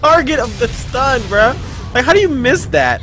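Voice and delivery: high, loud incredulous scolding; frustrated gamer berating teammate; exasperated rant. Real, not acted.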